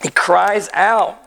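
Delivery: drawn-out hoarse cry, slow; hoarse slow outcry. Real, not acted. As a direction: let the voice crack and stretch slowly. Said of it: "my hoarse voice this morning".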